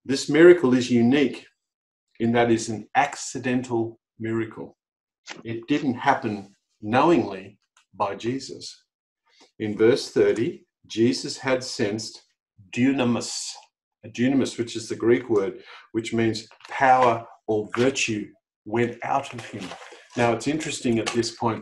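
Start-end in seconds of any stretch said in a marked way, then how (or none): none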